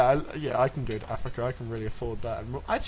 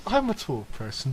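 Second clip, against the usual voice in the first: normal talking voice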